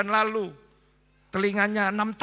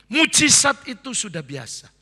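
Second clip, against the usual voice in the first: much louder, every word of "Mujizat itu sudah biasa" hammered out; preached declaration; 4 semitones higher, 11 dB louder than usual. Real, not acted. Preacher telling the congregation, "Mujizat itu sudah biasa", loudly. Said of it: "Saya ulang ya".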